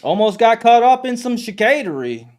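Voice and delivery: hyped teaser. Real, not acted. Host hypes his New Orleans story to the stream, excited and loud. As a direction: sell the story like a trailer line.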